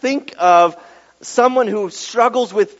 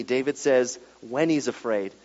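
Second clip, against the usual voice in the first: ordinary delivery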